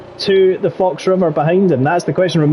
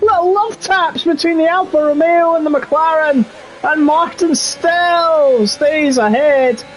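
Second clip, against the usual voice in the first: building from excited to a high-pitched shout; rising excitement; 11 semitones higher than usual. Real, not acted. Commentator calling a near collision between the cars, building to a high excited shout. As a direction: climb higher and higher until you're shrieking at the near crash. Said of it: "calm yourself down there, Banshee".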